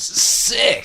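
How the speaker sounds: high, drawn-out cry; high exclamation. Retold or imitated. Kevin reacting to the pigeon lady, high drawn-out exclamation. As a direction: let the word ring out high and long. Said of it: "She turns around and goes".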